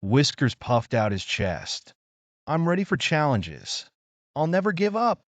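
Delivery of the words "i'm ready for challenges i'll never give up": higher, eager little kitten voice; determined kitten. A narrator's reading. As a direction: puff up and declare it proudly. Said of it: "in determination".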